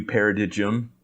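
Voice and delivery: "paradigm" said as pa-ra-DIDGE-um; mispronounced paradigm. Retold or imitated. Host confesses his misread word, "paradigm" as para-DIDGE-um. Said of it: "had no idea that was how you pronounce".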